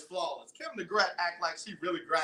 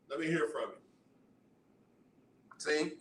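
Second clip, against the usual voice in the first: ordinary talk, nothing marked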